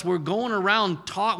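ordinary talk, nothing marked

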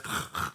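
throaty Hebrew "ch" sound; guttural throat rasp. Shown as a demonstration. Pastor showing the Hebrew ending, a guttural throat rasp. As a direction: scrape the back of the throat. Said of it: "you have to have this".

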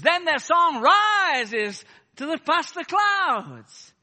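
high, loud, slow revivalist cry; drawn-out, soaring mock pulpit shout; imitating American preacher. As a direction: mimic an Afro-American preacher lifting the roof. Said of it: "an Afro-American preacher".

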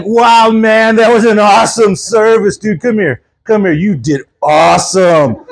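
drawn-out surfer-dude enthusiasm; hippie surfer pastor. Retold or imitated. Surfer pastor praises the preacher after a service, hippie surfer voice. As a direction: play a stoked seventies surfer pastor. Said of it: "Yeah, that's how he talked".

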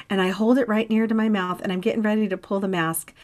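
ordinary delivery

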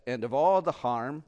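hint of a Scottish lilt; Scottish accent. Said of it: "I always say it in a Scottish accent".

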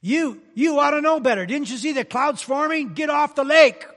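high, loud, exasperated scolding; shouting at foolish fishermen; mock angry scolding. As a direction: chew them out like a fed-up boss. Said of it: "Jesus didn't say".

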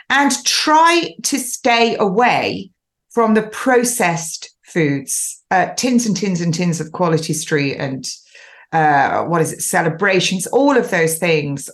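usual speaking voice

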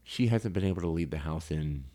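ordinary talk, nothing marked

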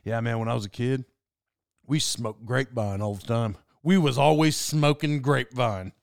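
lightly voiced gruff dad reminiscing; rough dad voice. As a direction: do dad as a gruff small-town guy. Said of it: "he might as well talk like that".

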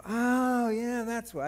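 high, drawn-out "oh"; mock realization. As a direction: act out the penny dropping. Retelling his sudden realization.

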